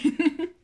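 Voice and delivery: a sudden high burst of laughter; embarrassed laugh. Real, not acted. Laughing at her own bad Flemish accent, a high burst of laughter.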